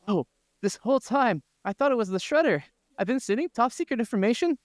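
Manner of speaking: high, put-on voice of a spy realizing his mistake; mock panicked fax voice. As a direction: squeal it out like a cartoon villain caught out. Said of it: "he saw a fax saying".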